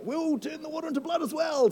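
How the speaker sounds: high, smug wizard voice; lightly voiced gloating wizard; mock wizard boast. Pharaoh's wizards boasting to the crowd, high mock voice. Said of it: "Pharaoh's wizards are like".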